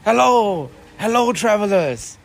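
loud, hearty hello from a friendly dwarf; dwarf greeting the party, hearty and loud. Acted. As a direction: call out a welcome to the travelers. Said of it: "He walks around to go greet you guys".

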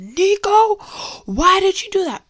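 high-pitched telling-off, imitated; upset scolding. Owner scolds dog for peeing, upset and high-pitched. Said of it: "I was so upset".

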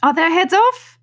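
very high, loud shouted question. A narrator's reading. Queen checking on the executions, shouting. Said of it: "shouted the queen".

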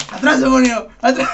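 loud, high hammed-up exorcism; mock exorcist shout. Friends mocking a vomiting drunk girl, shouting like an exorcist. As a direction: banish the demon with a cross.

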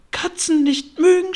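high, loud outraged mouse shriek; furious squealing mouse, far above normal pitch; screeching, furious. A narrator's reading. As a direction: screech it back in disbelief. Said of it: "schrie die Maus mit kreischender, wütender Stimme".

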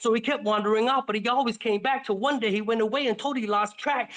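ordinary delivery, nothing marked

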